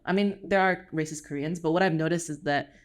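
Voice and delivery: normal talking voice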